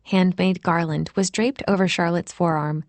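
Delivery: ordinary delivery, nothing marked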